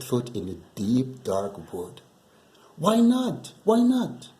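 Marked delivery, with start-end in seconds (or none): 2.8-4.3 put-on much higher, eager child asking; high, pleading child's question; little child voice. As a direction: pitch it up like a curious little kid. Gruffalo's child asks about wood.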